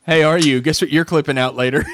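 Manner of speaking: normal talking voice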